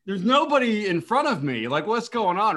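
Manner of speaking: high, loud bewilderment; baffled and alarmed; confused. Retold or imitated. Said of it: "I'm like".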